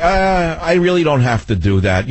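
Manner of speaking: mildly mocking put-on reluctance; old person excuse. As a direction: act out an aging person begging off. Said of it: "when you get old, you say".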